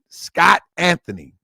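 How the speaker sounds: ordinary delivery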